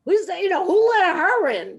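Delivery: a hint of outraged young men calling out; indignant guys. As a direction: sputter like an offended college guy. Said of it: "the guys were just like".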